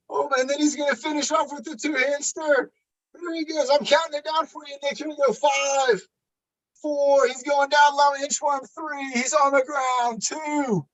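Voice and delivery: high, energetic play-by-play call; hyped countdown. Acted. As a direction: count it down like a live announcer.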